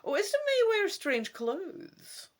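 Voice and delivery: hoog en langzaam, geheimzinnige oude dame; mysterieuze oude vrouw. Acted. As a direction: laat het klinken als een raadsel. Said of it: "Madame Ursula adds".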